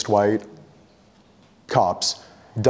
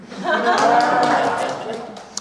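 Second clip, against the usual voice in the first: a high burst of laughter at his own comeback; 13 semitones higher than usual. Real, not acted. Laughing at his own comeback to the cop.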